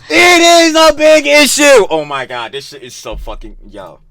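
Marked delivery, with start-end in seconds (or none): 0.1-1.9 high, loud, drawn-out retort; outraged shout. Real, not acted. Reactor contradicting the clip's big issue remark, outraged shout. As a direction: shout it back, stretching every word.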